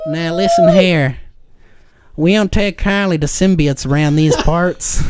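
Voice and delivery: lightly voiced hillbilly sheriff. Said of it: "in a redneck voice".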